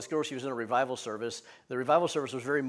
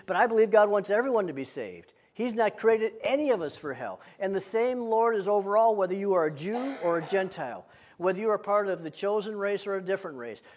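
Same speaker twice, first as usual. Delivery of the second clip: raised, ringing pulpit delivery; impassioned preaching; 4 semitones higher, 4 dB louder than usual. Real, not acted. Preacher telling the congregation salvation is for everyone, impassioned. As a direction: build phrase on phrase with conviction.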